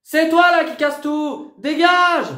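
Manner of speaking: real screaming fight; furious shouting. Real, not acted. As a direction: scream back at her. Shouting match with mother.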